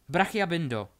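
loud, high incantation; called-out spell. Acted. Hermiona binds the fallen Delphi, calling out loudly. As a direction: call the spell out forcefully. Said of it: "zvolala Hermiona".

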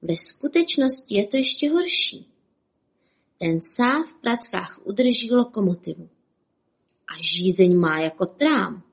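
ordinary delivery, nothing marked

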